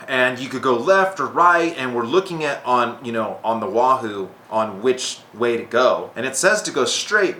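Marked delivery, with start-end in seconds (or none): none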